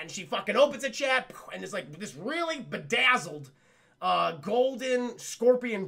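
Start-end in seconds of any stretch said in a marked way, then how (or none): none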